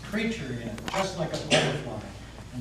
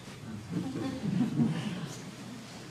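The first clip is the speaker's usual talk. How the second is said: sinister chuckle; 7 semitones higher, 5 dB quieter than usual. Acted. Devil laughs at victim.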